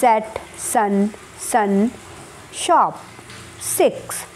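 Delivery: सेट, सन, शॉप, सिक्स, the श in शॉप set apart; odd-word drill. Shown as a demonstration. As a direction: read the row slowly for the class. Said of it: "चलिए करते हैं". (धीरे-धीरे शब्द पढ़ना)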